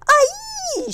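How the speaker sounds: very high, loud panicked shriek. A narrator's reading. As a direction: shriek as if about to crash. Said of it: "shrieked Flap".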